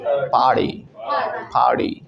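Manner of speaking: "पार्टी" said as par-dee; t as light d. Shown as a demonstration. Teacher demonstrates American sound, "पार्टी" with a light d. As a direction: soften the t of "पार्टी" into d. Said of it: "टी में हल्का सा डी add".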